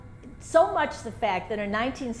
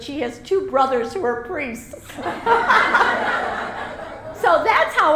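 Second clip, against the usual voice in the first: laughing through the words, pitch up; laughing as she tells the family joke; 6 semitones higher, 7 dB louder than usual. Real, not acted. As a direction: let the laugh break in as you speak.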